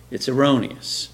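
ordinary talk

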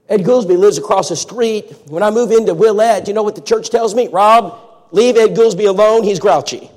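loud, high storytelling preacher; preaching at full force. Acted. Preacher telling the church a story, full force. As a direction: drive the story home to the congregation.

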